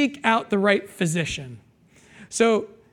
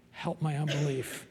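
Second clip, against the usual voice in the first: very soft, low prayerful plea; a whispered-soft plea; hushed prayer; 6 semitones lower, 13 dB quieter than usual. Acted. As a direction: pray it almost to yourself.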